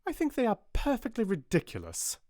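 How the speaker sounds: sharp, high, cutting dismissal; stern headmistress scoffing; sharply. Acted. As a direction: snap it out with scorn. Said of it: "replied Miss Minchin sharply".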